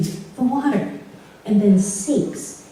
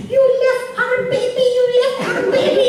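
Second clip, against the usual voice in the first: much higher, louder accusing shriek, laughing; mom screaming; 14 semitones higher, 3 dB louder than usual. Retold or imitated. Mom screaming at dad for leaving their baby, high shriek. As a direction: tear into him for abandoning the child. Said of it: "my mother is screaming".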